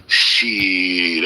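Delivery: ordinary talk, nothing marked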